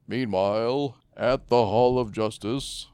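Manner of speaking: booming, slow cartoon narrator; loud dramatic announcer, drawn out; Super Friends narrator. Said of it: "was that Ted Knight?".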